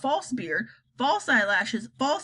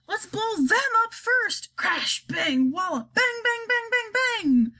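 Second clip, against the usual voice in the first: gleeful army chief; 11 semitones higher than usual. Acted. Army chief eager for explosions.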